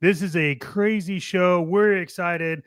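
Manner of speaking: usual speaking voice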